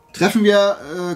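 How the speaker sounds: ordinary delivery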